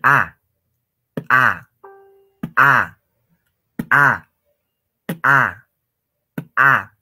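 repeated loud popping "pa", evenly spaced; ejective P. Shown as a demonstration. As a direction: burst each P of "pa" sharply. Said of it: "reventar o explotar algo". (pa eyectiva, repetida como explosión)

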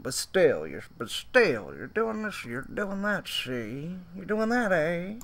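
lightly voiced stammering suspect; mocking the suspect. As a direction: parody his fumbling excuse. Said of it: "He had to think about it".

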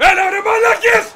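high, loud outburst; angry yell. Real, not acted. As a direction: yell at useless teammates. Yells at teammates.

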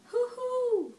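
put-on high owl hoo-hoo for a toddler; owl hoot. Shown as a demonstration. Parent hoots an owl sound for the toddler, high.

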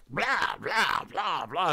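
hammed-up ranting at high volume; ranty. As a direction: rant loudly in nonsense syllables. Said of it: "if you're familiar with Ranty Chris".

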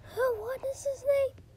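high-pitched, crying whine; high whiny voice. Pretend. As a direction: wail it in a whine. Teacher forgets student names.

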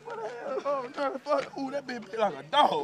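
shocked squeal after a bite; startled yelp. Real, not acted. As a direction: yelp as if suddenly bitten. Bitten by dog, yelps.

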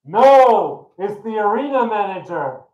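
high, loud announcement from above; booming voice. Acted. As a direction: boom out the punchline. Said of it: "And the voice says".

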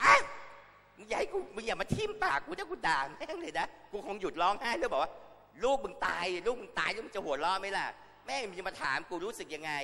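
high, loud, raging scolding; grieving mother yelling at a reporter, furious; furious outburst. Acted. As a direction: explode at the reporter in fury. Acting an enraged grieving mother.

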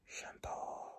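very soft, voiceless whisper; ghostly whisper. Acted. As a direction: whisper the name like a creepy phantom. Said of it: "fluisterde hij". (griezelig gefluister)

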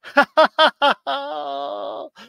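high, drawn-out burst of laughter; loud laugh. Real, not acted. Laughing at the riddle's punchline.

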